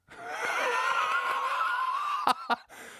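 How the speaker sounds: big high burst of laughter, half shouted. Real, not acted.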